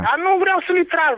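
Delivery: refusal yelled high and loud; angry shout. Real, not acted. Neighbour refusing to draw the curtains, shouting angrily. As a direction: hurl the refusal back.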